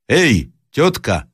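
high, drawn-out hail; man calling out. A narrator's reading. Traveller hails old woman through window, calling out. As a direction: hail the house from outside. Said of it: "раздался мужской голос".

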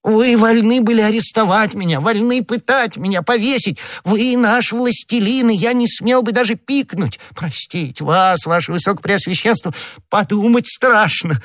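very high, pleading servile voice; подобострастный Бонасье, трепет. Acted. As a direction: grovel in awe before a mighty master. Figure of Bonacieux, servile. (очень высокий угодливый голос, с трепетом)

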